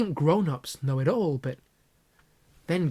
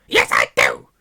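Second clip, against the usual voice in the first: much lower, loud yell; screech; 14 semitones lower, 11 dB louder than usual. Acted. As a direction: shout back in defiance. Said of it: "Aunt Petunia screeched".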